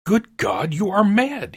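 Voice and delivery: high, loud, horrified exclamation; aghast cry. A narrator's reading. Husband appalled by his wife's plan, aghast cry. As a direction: recoil aghast at the idea. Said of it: "he cried, aghast".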